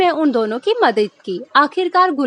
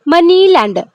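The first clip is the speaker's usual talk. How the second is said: मनी lender with मनी loud and high; stress on MON; 4 semitones higher, 5 dB louder than usual. Shown as a demonstration. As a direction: hit the first syllable of मनी lender. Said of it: "so it's मन". (मनी lender में मन पर ज़ोर)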